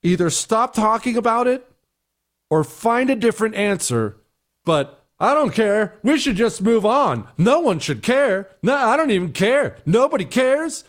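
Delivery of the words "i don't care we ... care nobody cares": high, breezy brush-off; mocking a careless shrug; dismissive mock quote. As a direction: toss it off like nobody cares. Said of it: "is a horrible thing to say".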